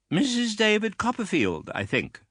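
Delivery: put-on high, imperious old lady voice; stern aunt. A narrator's reading. Stern aunt sizing up the widow, high and imperious. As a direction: look down on her from a height. Miss Betsy greets the mother.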